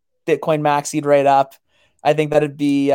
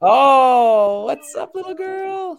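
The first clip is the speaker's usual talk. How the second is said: singsong puppet show host; 9 semitones higher, much slower than usual. Real, not acted. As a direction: coo it, slow and sweet. Greeting a small child.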